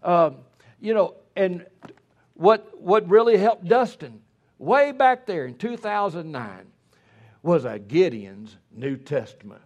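loud, high revival sermon voice; preaching at full force. Real, not acted. Preacher exhorting parents and teenagers, loud and high. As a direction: preach it hard to the congregation.

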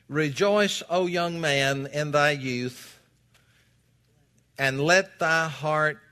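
loud, slow, booming King James reading; scripture reading. Acted. Preacher reading Ecclesiastes to his congregation, booming and slow. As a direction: proclaim each phrase to the pews.

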